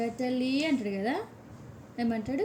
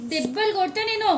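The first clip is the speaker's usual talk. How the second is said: raised, louder mock scolding; mock threat; 7 semitones higher, 8 dB louder than usual. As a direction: pretend to threaten a spanking, loudly. Mother threatens child over bath.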